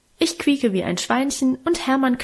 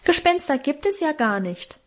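put-on a higher squeak of the youngest boy; squeaky little voice; 5 semitones higher than usual. Youngest brother pipes up, higher squeaky interruption. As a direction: pipe it up high like a small child. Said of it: "piepste unser Jüngster".

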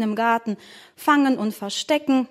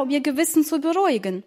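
plain reading voice

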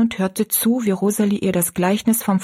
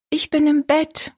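put-on higher small girl's voice; child Rosalie; 9 semitones higher than usual. Girl answering her father, higher childlike voice. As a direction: answer like a little girl from bed.

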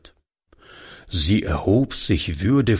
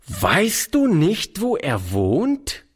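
slow, reproachful questioning; strafend; 6 semitones higher, slower than usual. Acted. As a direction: drag out the question as a scolding. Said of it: "wiederholte sie in strafendem Ton". (vorwurfsvoll und langsam)

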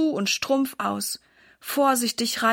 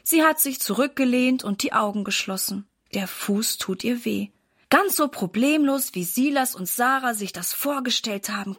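ordinary delivery, nothing marked

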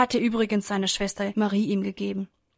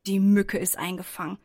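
ordinary delivery, nothing marked

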